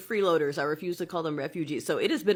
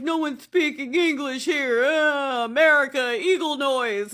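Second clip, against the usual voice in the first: higher, louder hammed-up tourist abroad; mock loud American tourist; 6 semitones higher, 4 dB louder than usual. Mocking Americans abroad who complain, hammed-up tourist. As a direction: lampoon the ugly American. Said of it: "Our ones travel and they get".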